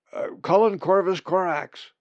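high, loud, hasty announcement; shout. A narrator's reading. Colin hurriedly tells the raven his name, shouting. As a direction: blurt the name out at full voice. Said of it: "Colin shouted".

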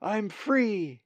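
high-pitched cry of release; exultant cry. A narrator's reading. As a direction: let it soar, high and elated. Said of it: "he cried".

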